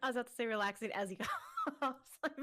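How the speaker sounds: talking through laughter; laughing. Real, not acted. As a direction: laugh helplessly while getting the words out. Cracks up over relaxing coughs slip.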